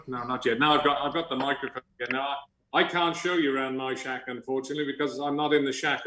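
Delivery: ordinary talk, nothing marked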